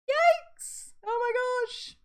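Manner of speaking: high, loud startled yelp; shocked yikes. Real, not acted. Streamer reading a scary comment, high shocked yelp. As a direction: recoil at a seventy-foot jump. Reacting to chat about jumping.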